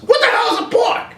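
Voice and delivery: very high, incredulous; baffled Marty. Acted. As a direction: squeak the question in disbelief. Marty asks in skit.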